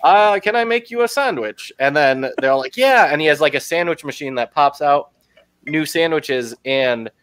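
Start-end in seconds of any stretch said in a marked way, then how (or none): 0.0-1.7 high, prim robot butler voice; polite butler robot offering a sandwich. Retold or imitated. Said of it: "little robot butler guy". 2.8-3.1 eager yes. Retold or imitated. Crowd cheering yes.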